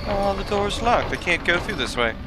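ordinary talk

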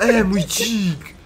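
excited. Real, not acted. Delighted at his boar.

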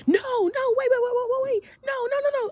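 frantic high-pitched no no wait wait; panicked pleading. Retold or imitated. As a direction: squeal it out in a rush of panic. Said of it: "I immediately went".

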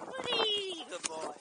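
loud high call to the dog. Real, not acted. Calling dog.

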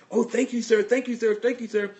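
high, loud, startled gratitude from the lady; shocked thanks. Retold or imitated. Stranger thanking the man who filled her tire, shocked and high. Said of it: "The lady was shocked".

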